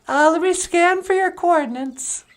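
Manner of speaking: very high, bright greeting; a cheerful high puppet hello; Natasha's puppet voice. Acted. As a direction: pitch way up for the puppet. Puppet Natasha operates teleporter.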